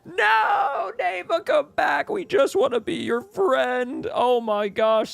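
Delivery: very high, drawn-out tearful squeal; overcome, squealing pretend weepiness; mock tearful. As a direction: squeak it out slowly, as if about to cry. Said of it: "I'm gonna cry".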